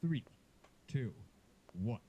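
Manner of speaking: lightly voiced fight announcer. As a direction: announce the final round dramatically. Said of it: "the round three voice".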